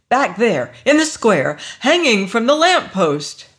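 loud, rising desperate plea; urgent insistence. A narrator's reading. Lois urgently insists on the body.

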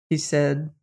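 usual speaking voice

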